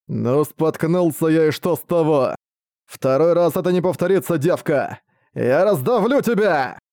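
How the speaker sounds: медленно, угрожающе, нарастая; чудовище обещает раздавить; злобный монстр. Acted. (угрожающий голос монстра)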